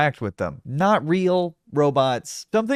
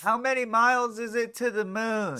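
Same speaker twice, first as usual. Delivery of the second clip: mimicking a fan; 7 semitones higher, 5 dB quieter than usual. Mimics fan asking robot.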